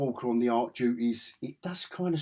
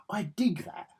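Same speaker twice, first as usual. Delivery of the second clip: whispered try at an American accent that slips English; attempted American accent; 8 semitones higher than usual. Shown as a demonstration. Whispering an American phrase to viewers, accent drifting English. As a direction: breathe it like a laid-back American. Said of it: "I've done a more English accent".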